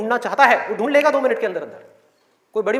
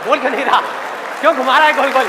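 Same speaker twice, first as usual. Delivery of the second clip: raised, exasperated demand to get on with it; impatient audience; 3 semitones higher, 4 dB louder, faster than usual. Acted. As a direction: act out listeners fed up with waiting. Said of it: "सबके अंदर से आवाज़ आ रही".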